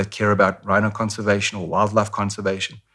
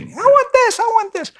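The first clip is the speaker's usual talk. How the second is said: put-on much higher, whining little child; whiny kid voice; 5 dB louder than usual. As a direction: beg like a child in a shop. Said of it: "when you're a little kid".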